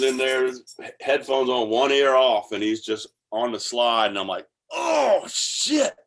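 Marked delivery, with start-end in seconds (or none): 4.7-5.9 high, awed oh shit; stunned by the solo; amazed. Retold or imitated. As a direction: gasp it out in wonder, pitched way up. Amazed at the slide take.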